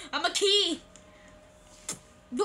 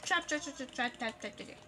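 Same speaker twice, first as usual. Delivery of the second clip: fading chatter; 3 semitones lower, 7 dB quieter than usual. Real, not acted. Repeats word after scare.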